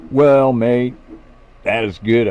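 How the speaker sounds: loud, playful put-on Australian accent; teasing a friend by copying his Australian accent; mock Aussie accent. Friend mimics his mate's Australian accent, loud and playful. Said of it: "playing with his accent and slang".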